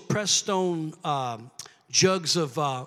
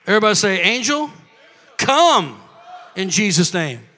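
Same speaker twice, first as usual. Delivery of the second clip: call and response; 9 dB louder than usual. Real, not acted. Preacher leading the congregation in a prayer call. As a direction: lead the room in the words.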